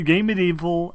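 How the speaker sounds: normal talking voice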